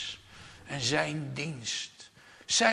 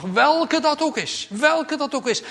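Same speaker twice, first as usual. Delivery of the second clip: ringing plea; 12 dB louder than usual. Real, not acted. Preacher pleading with the congregation, high and loud. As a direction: cry it out to the church.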